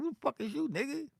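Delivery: high squeaky mock of his own surprise; hammed-up shocked challenge; startled demand. Meeting the drummer at his door, high mock shock. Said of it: "I was like".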